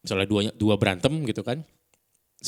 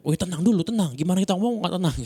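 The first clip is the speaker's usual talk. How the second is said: high, loud, frantic calming-down; loud, high-pitched, agitated telling-off; unpleasant agitated intonation; 10 semitones higher, 5 dB louder than usual. Shown as a demonstration. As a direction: demand calm in a worked-up shout. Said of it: "intonasinya nggak enak".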